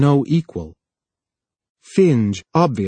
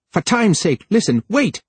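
high, frightened pleading; frightened plea; 8 semitones higher than usual. A narrator's reading. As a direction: beg him to stop, panicked. Finge pleading in fear.

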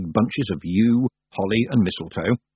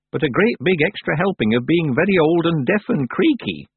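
lightly voiced brisk old witch; a hint of a gruff, kindly crone; 7 semitones higher than usual. A narrator's reading. Old witch comforts crying girl.

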